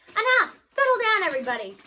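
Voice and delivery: loud, high teacher's bellow; stern shout. Acted. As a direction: cut through the ruckus with a yell. Teacher quieting a wild class.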